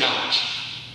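hushed, slow we say ouch; slow breathy ouch of sympathy; soft ouch. Shown as a demonstration. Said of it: "have historically said ouch".

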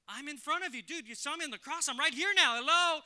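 loud, high, incredulous Jesus voice; Jesus pleading to be believed, fully voiced; preacher acting Jesus to doubting Thomas, loud and exasperated. Jesus answers doubting Thomas, acted.

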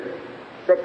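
ordinary talk

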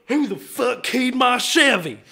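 high, loud, drawn-out Texan outrage; hokey drunk Texan demanding answers; angry drunk Texan. Acted. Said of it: "Angry, drunk Texan".

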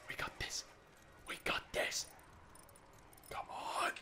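hushed unvoiced pep talk; whisper. Real, not acted. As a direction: whisper so the horde won't hear. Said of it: "I'm just gonna stay so quiet".

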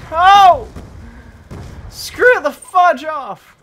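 full-volume furious scream; screaming rage. Real, not acted. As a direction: scream at the game. Raging at game.

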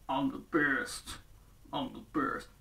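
low, soft, slow gloating mumble; a bully muttering to himself; smug muttering. Retold or imitated. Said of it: "Don't matter".

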